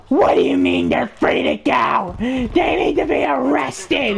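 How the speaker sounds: gasp, then high screechy indignation; mock outraged shriek. Lady objects to kids going free, mock outraged shriek. Angry lady protests to the cop.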